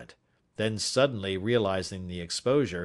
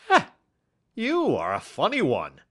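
chuckling; 7 semitones higher, 5 dB louder than usual. A narrator's reading. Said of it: "chuckled the inspector".

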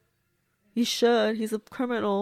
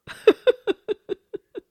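sudden high, loud burst of laughter; bright squealing laugh; 13 semitones higher, 8 dB louder than usual. Real, not acted. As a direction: cackle at your own joke, high and loud. Laughs at own joke.

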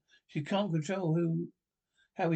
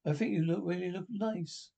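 usual speaking voice

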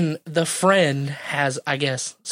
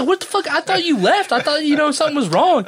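startled outburst; 10 semitones higher, 3 dB louder than usual. Retold or imitated. Relieved friend reappears after worry.

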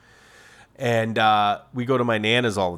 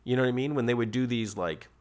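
normal talking voice

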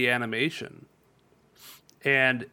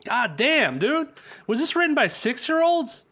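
much higher, louder disbelieving cry; shocked at how bad the script is; incredulous outburst; 11 semitones higher, 3 dB louder than usual. Real, not acted. Cohost ranting about the script, incredulous high outburst. Outraged at bad movie writing.

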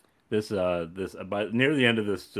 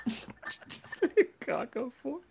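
incredulous; 7 dB quieter, slower than usual. Real, not acted. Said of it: "Did you".

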